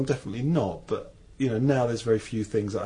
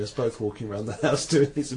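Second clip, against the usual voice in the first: normal talking voice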